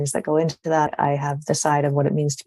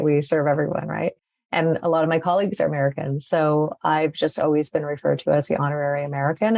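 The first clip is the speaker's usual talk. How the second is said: normal talking voice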